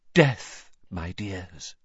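Trelawney's voice. A narrator's reading. Trelawney's dramatic prophecy.

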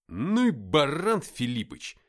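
loud, drawn-out insult; exasperated outburst. Acted. Fighter curses comrade over the grenade, exasperated outburst. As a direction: curse your comrade slowly and forcefully. Narrator cursing comrade after blast.

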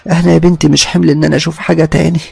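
high, agitated retelling of a scare; frightened mother-in-law. Acted. Mother-in-law tells why she fled the bedroom, frightened. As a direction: pour it out in rising alarm. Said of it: "كانت مخضوضة قوي".

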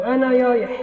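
أنا يايح with the r said as y; lisp r as y. Shown as a demonstration. Teacher demonstrating a lisp: r turned into y. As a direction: say يايح gliding y for r. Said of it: "قلبت الراء ياء".

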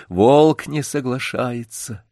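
ordinary delivery

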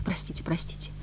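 very soft, whispered apology; meek muttering. A narrator's reading. Chastened woman apologising to the messenger, muttering meekly. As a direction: murmur it submissively. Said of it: "бормотала покорная теперь Маргарита".

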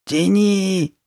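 high, urgent plea; high-pitched imploring cry; pleading. A narrator's reading. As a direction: beg her desperately to pull. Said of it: "взмолилась Карли Бет".

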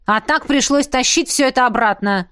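mildly irritated complaint; indignant, growled reproach; angry growl. A narrator's reading. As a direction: snap in mild anger at a partner. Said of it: "рыкнула незнакомая женщина".

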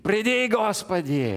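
loud, high, slow cry; preacher crying out for the Lord to come. Acted. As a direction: call it out, stretching each word. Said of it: "которая кричит".

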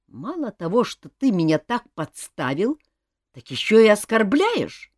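offended, pitch rising high. A narrator's reading. Cousin protesting to the king after being set up, offended. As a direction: protest the insult, pushing up in pitch. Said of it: "обиделся Элмар".

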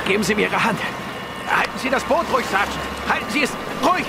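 high, strained calls to hold steady; high urgent shout over the water. Acted. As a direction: call out while hauling a body in. Pulling man from water.

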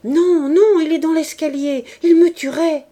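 high, loud panicked refusal; a young wife in panic refusing to go back out; terrified. A narrator's reading. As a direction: cry out in terror of the man on the stairs. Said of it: "Berthe fut reprise de terreur".

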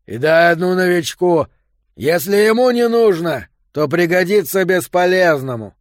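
loud, high calling out; shouting. A narrator's reading. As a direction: shout down the corridor. Yo shouts across the corridor.

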